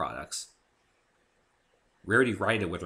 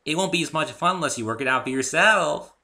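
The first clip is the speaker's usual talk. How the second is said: high, loud bubbly retort; cheeky pony comeback; bright playful counter; Pinkie voice; 9 semitones higher, 8 dB louder than usual. A narrator's reading. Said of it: "countered Pinkie".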